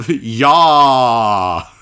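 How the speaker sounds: drawn-out open-throated holler; long loud yell. Acted. Noise made at the sound-triggered camera, loud yell. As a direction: let out one long shouted cry.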